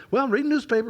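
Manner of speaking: "Well, I'm reading newspaper," lifted high and eager; chatting eagerly with a church member; lively reply. Retold or imitated. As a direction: make "reading newspaper" sound exciting. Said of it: "if that had been an average couple person from church".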